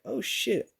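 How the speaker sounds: high-pitched "oh shit" of realization; mock panic at being found out; caught-out alarm. Acted reaction to being caught.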